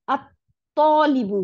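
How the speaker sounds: "আত-তলিবু" loud and very slow, stopping on the T; slow, loud model of "আত-তলিবু", no L sound; doubled T. Shown as a demonstration. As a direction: hold the T closed in "আত-তলিবু", then a long vowel. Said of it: "দুইটা ত হয়ে গেল".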